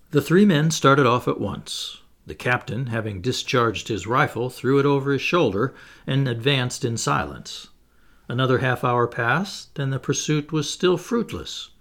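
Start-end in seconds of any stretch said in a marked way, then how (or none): none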